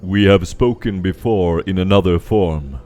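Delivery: loud, resonant creature voice; booming voice. Acted. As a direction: speak like a towering being.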